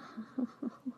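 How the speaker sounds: short rising laugh; brief breathy chuckle; light chuckle. Acted. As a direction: let a little laugh escape. Amused reaction between whispered lines.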